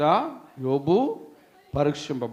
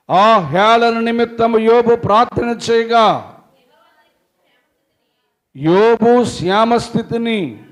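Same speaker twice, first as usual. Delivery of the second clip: loud, high fervent sermon voice; full-force preaching; 9 semitones higher, 13 dB louder, slower than usual. Acted. Preacher retelling Job's friends to congregation, fervent. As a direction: preach it out with fire.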